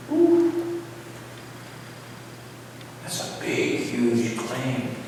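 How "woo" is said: high whoop. Real, not acted. Reacts to huge claim.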